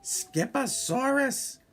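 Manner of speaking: very high, drawn-out question; surprised mama. Acted. Mama walks in and echoes his dinosaur name, high and amazed. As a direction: stretch the word in high disbelief. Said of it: "declared Mama Junebug Jones".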